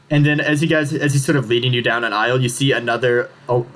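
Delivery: usual speaking voice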